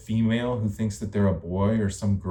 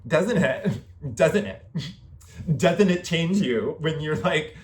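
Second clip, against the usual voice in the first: higher, giggly mock voice; 5 semitones higher than usual. Taunting the person just mimicked, high giggly mockery. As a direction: tease in a silly high voice, laughing.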